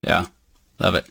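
usual speaking voice

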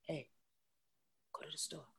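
soft, breathless whisper of a gentle guide; spirit whisper. Pretend. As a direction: hush it like a voice from beyond. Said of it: "This is how spirit talks".

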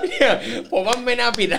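high, loud, laughing; teasing through a burst of laughter; real laughing tease. Real, not acted. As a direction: toss in the joke while cracking up. Co-host joking about the misspelling.

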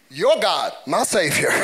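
ordinary delivery

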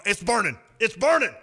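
hammed-up urgent alarm; mock alarm. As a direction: cry out as if the popcorn is burning. Acts out noticing burnt popcorn.

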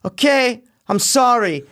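high-pitched mock apology; put-on high voice for an apologetic heroine; Wonder Woman. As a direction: imitate a film heroine apologizing, pitched well up. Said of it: "listen to you do Wonder Woman".

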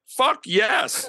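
loud, high, emphatic acceptance; re-enacted thrilled outburst; excited yes. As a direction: jump at the offer, voice shooting up. Retells jumping at a big fee.

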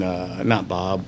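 ordinary delivery

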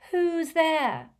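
high dramatic call. Acted. Trelawney calling into the dark room, dramatic. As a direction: call out to an unseen intruder. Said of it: "And then I called out".

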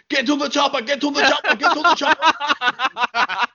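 high, strained mock yell, repeated over and over; Arnold Schwarzenegger. Host mimics his phone's message tone, high mock Arnold yell. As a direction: yell it like a looping action-hero message tone. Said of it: "So all I hear is".

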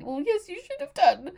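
high, breaking, tearful comeback; tearful retort. Retold or imitated. Patient in tears answering the nurse, voice cracking high. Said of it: "looked at her through my tears and went".

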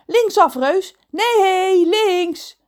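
high, loud called-out directions; excited boy's frantic correction; urgent shouting. Acted. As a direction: yell directions to the driver.

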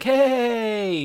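high, drawn-out greeting; mock hailing call. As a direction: call out to someone, high and put-on. Acting out Corey grabbing another guy.

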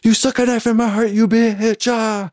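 high, fast, hammed-up hurt yelling; mock heartbroken yelling. Yelling at his girlfriend on the phone, high mock heartbreak. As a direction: rant like a wounded lover, pitch way up. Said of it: "So I'm doing, like, that all over the phone".

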